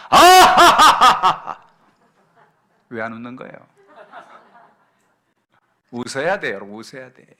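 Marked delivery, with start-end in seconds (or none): 0.1-1.6 high, loud, slow drawn-out ha-ha-ha; big demo laugh. Shown as a demonstration. Pastor models a laugh for the congregation, big and loud. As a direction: laugh out big to show them how. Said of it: "다 같이 한번 웃어봅시다".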